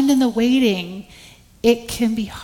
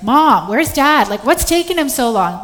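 less friendly asking; 4 semitones higher, 7 dB louder than usual. Retold or imitated. Mother imitating her impatient son demanding where dad is. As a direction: whine it out like a fed-up child. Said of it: "a little less, like, friendly asking".

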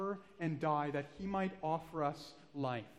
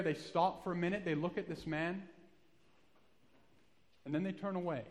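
usual speaking voice

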